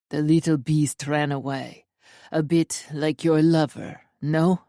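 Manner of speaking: low, taunting Russian intruder; Russian accent. A narrator's reading. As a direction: needle her in a deep Russian accent. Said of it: "The Russian accent".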